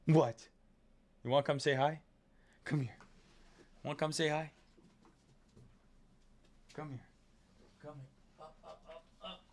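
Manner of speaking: soft coaxing pet voice; calling the dog. Real, not acted. Streamer calling his dog to the camera, softly coaxing. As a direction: coax the dog over gently.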